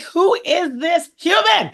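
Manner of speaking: high, drawn-out hype question; high, slow teasing call-out; playful excited question. Acted. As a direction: build suspense for the guest. Teasing guest introduction.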